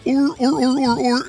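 high-pitched repeated "or" creature calls; sand person cry. Shown as a demonstration. Showing friends an old sand person impression, high repeated cries. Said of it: "When I used to do it, I'd just go".

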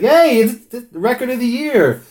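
high, loud mock praise from everybody; gushing fans. Imitating everyone praising his record, high and gushing. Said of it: "Everybody's like".

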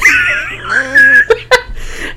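high helpless laughter; real high-pitched laughing fit. Real, not acted. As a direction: crack up at your own line. Cracks up at own imitation.

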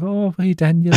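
high-pitched imitation of an entertainer. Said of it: "he does, like, [name] impressions".

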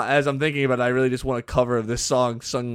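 ordinary delivery

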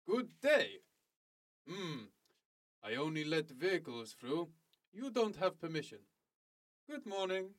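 stiff game-guard accent, lightly put on; deadpan villain accent from a cheap game; mock Eastern European accent. Gamer quoting a gate guard, in a lightly put-on Eastern European accent. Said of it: "generic video game Eastern European villain accent".

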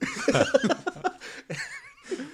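high burst of laughter; laughing. Real, not acted. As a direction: cracks up laughing. Laughs at own accent.

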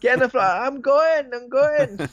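high-pitched bad Scottish impression; squeaky mock Scottish. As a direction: shriek it in a silly Scottish accent, breaking into a laugh. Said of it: "something like that".

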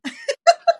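squealing high laugh; real high-pitched laughter. Real, not acted. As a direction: let a high giggle burst out. Laughing at the made-up language.

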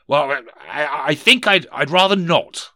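high, nervous, stammered refusal; faltering Scrooge. Acted. Scrooge declines the spirits' visits, high and hesitant.

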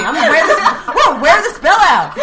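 laughing. Real, not acted. Joking, laughing through words.

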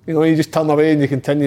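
ordinary delivery

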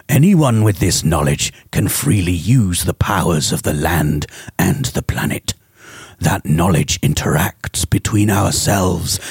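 low, slow, menacing villain read; slow theatrical villain menace. Acted. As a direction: read it like a brooding game villain. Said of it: "without the villain voice".

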